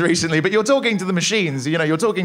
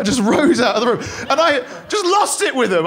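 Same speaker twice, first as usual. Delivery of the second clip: ordinary talk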